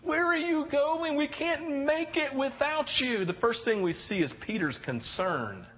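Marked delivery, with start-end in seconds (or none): 0.0-3.2 much higher, slow pleading; anxious plea. Acted. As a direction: beg him not to go. Peter's plea again.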